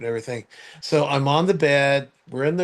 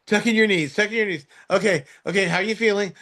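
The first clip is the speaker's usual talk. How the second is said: nurse orders; 6 semitones higher than usual. Retold or imitated. Nurses giving hurried orders.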